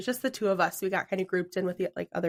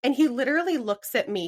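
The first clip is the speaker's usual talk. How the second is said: ordinary delivery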